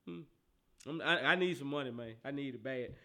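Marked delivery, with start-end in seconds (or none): none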